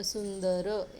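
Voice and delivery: सुंदर slow, the n held after सु; n sound shown. Shown as a demonstration. Teacher asking children what sound came, सुंदर drawn out on n. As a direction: stretch out सुंदर so the n is clear. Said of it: "न pronunciation आया".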